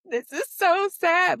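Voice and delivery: high, slow teary whine; replaying her own tearful reaction; a hammed-up weepy voice, pitched high; mock bawling. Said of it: "I'm bawling".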